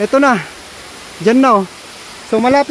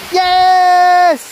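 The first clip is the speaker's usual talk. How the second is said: high, loud cheer; excited yes; 9 semitones higher, 4 dB louder than usual. Real, not acted. Climber cheering a small win, loud and high. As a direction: shout it out in triumph. Celebrating reaching the spot.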